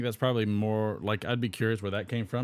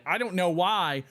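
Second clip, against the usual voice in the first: mild rising complaint; 6 semitones higher than usual. Acted.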